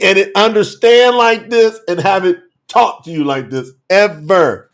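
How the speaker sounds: ordinary delivery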